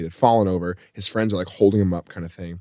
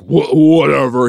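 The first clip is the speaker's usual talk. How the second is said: slow, higher, loud slurred brush-off; drunken dismissal; 4 semitones higher, 6 dB louder, slower than usual. Retold or imitated. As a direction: stumble over it like a drunk. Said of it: "He's, like, stumbling around".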